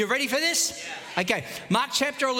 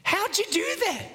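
amazed question; 11 semitones higher than usual. Retold or imitated. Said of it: "there's a question there".

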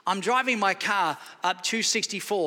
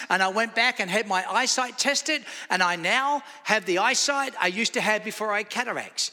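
normal talking voice

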